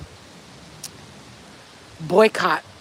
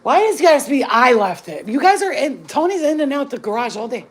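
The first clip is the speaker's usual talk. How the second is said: loud, high, indignant pushback; heated, louder arguing with the family; heated protest; 6 semitones higher, 9 dB louder than usual. Real, not acted. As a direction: raise your voice defending yourself. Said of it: "I'm not yelling".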